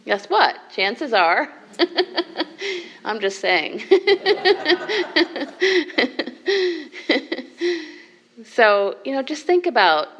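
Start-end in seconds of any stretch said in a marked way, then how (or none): 1.8-2.8 short high laugh. Real, not acted. Laughs at the crooks joke. 3.9-7.8 a long, rising laugh, high and bright; real high laughter rolling on. Real, not acted. Long laugh at the crooks joke.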